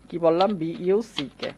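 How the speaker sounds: ordinary talk, nothing marked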